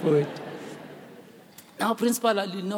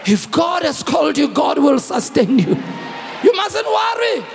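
loud, ringing assurance; preaching; 4 dB louder than usual. Acted. Pastor assuring the congregation God sustains them, preaching loud. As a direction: proclaim it to the church.

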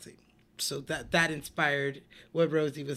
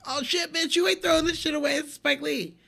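high, excited squeal of disbelief; pitched way up, hyped best friend; frantic friend; 11 semitones higher, 5 dB louder, faster than usual. Retold or imitated. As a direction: play the friend yelping at her to keep the card. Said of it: "her friend, like, dove down and picked it up, like".

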